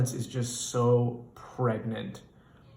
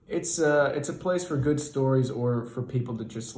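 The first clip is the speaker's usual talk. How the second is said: ordinary delivery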